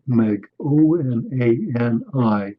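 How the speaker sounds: letter by letter, slow and clear; dictating a name to look up; spelled out letter by letter. Shown as a demonstration. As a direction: spell O N A N I slowly, one letter at a time. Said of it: "Go look her up".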